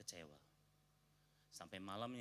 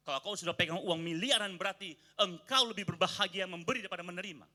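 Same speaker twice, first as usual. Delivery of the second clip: louder, higher preaching climb; rousing sermon; 8 semitones higher, 11 dB louder than usual. Acted. Pastor preaching about generosity to the church, rousing. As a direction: lift the church with the promise.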